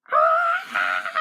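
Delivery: loud high yelp of fright; sudden cartoon scream; startled shriek. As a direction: yelp in alarm. Startled shout.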